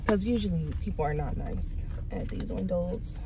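whispered aside. Real, not acted. Vlogger whispering her aside at the drive-thru window. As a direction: whisper it so the window can't hear.

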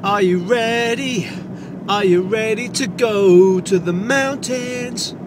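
sing-song calling. Pretend.

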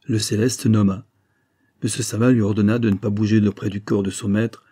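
ordinary delivery

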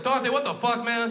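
loud, high mock outrage; hammed-up appalled shout; exasperated scolding. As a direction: yell at the kid in disbelief. Scolding godson in acted scene.

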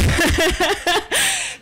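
high giggle of nerves; a real burst of nervous laughter. Real, not acted. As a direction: laugh off the jitters. Nervous pause in a talk.

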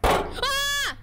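high, loud yelp of fright; startled shriek. Real, not acted. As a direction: shriek at a sudden scare. Startled by game scare.

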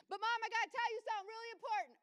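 high-pitched mock kid holler; yelling son. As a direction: imitate a child calling to mom. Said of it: "You don't have to yell at me".

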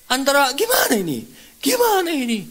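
high, agitated self-questioning; worked-up, exasperated mock panic; acted-out panic. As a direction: play frantic indecision, pitch climbing. Acts out his inner panic.

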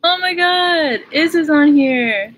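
high, loud, drawn-out delight; excited exclamation. Real, not acted. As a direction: squeal it out, stretching the end. Thrilled to spot a friend watching.

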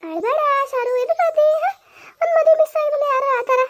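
pitched well up, coaxing her out of tears; high, insistent fussing; high-pitched chiding. Acted. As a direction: chide a crying girl in a high voice. Relative chides crying young woman.

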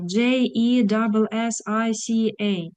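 slow, each letter separate; spelling out letter by letter. Shown as a demonstration. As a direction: spell the name slowly, letter by letter. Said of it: "Як ти вимовляєш по літерам?". (повільно, по літерах)

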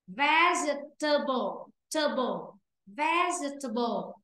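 Vegetable said slowly as VEJ-ta-bl, stress first; correct pronunciation. Shown as a demonstration. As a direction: model the right Vegetable. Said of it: "Chứ không đọc là vegetable".

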